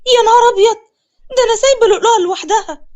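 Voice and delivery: high, loud, fast alarmed cry; remembering the little sister left alone; sudden shock. Acted. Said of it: "وافتكرت بصدمة". (فزع مفاجئ بصوت عالي وسريع)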